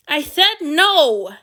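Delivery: slow, loud snap; snapped. A narrator's reading. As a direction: snap it out firmly. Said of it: "Antony snapped".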